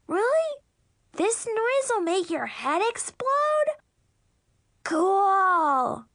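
put-on very high, thrilled little-girl voice; delighted little sister. Little sister thrilled head might explode, gleeful. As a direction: squeal the question, then drag out the last word.

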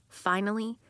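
usual speaking voice

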